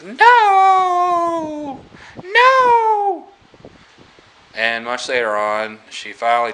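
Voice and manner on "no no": high, loud drawn-out mock wail; upset cry. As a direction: imitate a distraught woman crying out in protest. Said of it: "she's upset by this".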